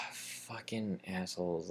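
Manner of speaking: slow, soft, resigned grumble; weary muttered disgust. Retold or imitated. Workers leaning on the counter, muttering wearily. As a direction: mutter it under a heavy sigh.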